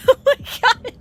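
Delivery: high, loud laughter through words; laughing disbelief. Real, not acted. Laughing at donation message.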